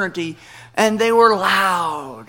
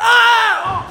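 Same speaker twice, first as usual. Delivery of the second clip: pained yelp; 6 dB louder than usual. Retold or imitated. Hugged on broken ribs.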